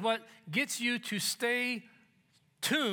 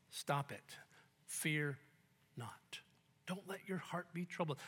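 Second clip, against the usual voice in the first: gentle reassurance; 4 semitones lower, 9 dB quieter, slower than usual. Acted. Jesus quoted softly.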